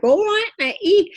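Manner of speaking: high, loud cockney quip; cockney boy voice. Retold or imitated. Medium imitating spirit boy Mickey, high loud Cockney. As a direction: pitch it up like a cheeky Cockney lad. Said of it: "he's very cockney ... Blimey type".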